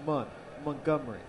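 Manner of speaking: Montgomery with a soft, barely hit T; "Montgomery" the local way, first syllable "Mun"; Southern pronunciation. Shown as a demonstration. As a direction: say Montgomery starting "Mun", T softened. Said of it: "don't hit the T hard".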